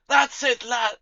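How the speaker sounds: booming high tavern keeper cheer; gruff barkeep. Acted. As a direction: shout it like a rowdy barkeep.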